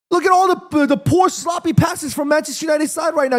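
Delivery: high, exasperated outburst; indignant rant. Real, not acted. Indignantly defending a blamed player.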